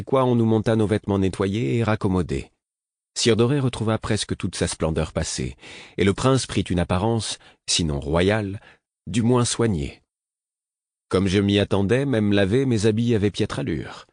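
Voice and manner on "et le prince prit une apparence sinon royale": plain reading voice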